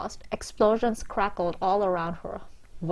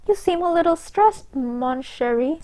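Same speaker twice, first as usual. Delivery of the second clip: put-on seductive French accent; 8 semitones higher than usual. A narrator's reading. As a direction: purr it like a flirty French hostess. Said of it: "in a seductively alluring French accent".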